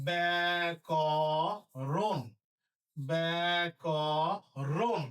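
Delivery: ব্যাকরণ drawn out as bæ-kɔ-ron; syllable-by-syllable demo. Shown as a demonstration. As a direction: say ব্যাকরণ very slowly, each syllable held apart. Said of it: "আবার একবার বলি". (ব্যাকরণ ধীরে ভেঙে ভেঙে উচ্চারণ)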